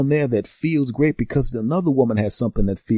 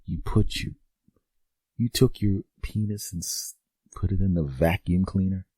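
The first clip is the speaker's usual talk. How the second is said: hushed, softer, stunned disbelief; quiet appalled question; horrified disbelief; 5 semitones lower, 7 dB quieter than usual. Retold or imitated. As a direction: say it like you can't believe your ears. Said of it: "looking at him like".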